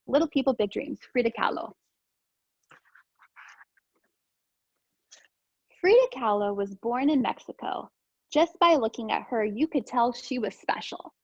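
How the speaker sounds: gentle storybook read-aloud; calm picture book narration; reading to children. Acted. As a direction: read it simply for young listeners. Reads picture book to class.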